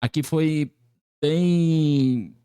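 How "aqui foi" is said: usual speaking voice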